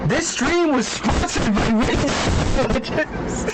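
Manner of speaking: crunchy, distorted cheap-mic sound; shitty mic. Shown as a demonstration. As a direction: read it through a terrible mic. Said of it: "I'ma put on the shitty mic real quick".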